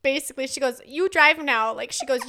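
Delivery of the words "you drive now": louder blunt demand; bossy toddler order. Retold or imitated. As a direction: insist like a little boss. Toddler ordering mom to drive.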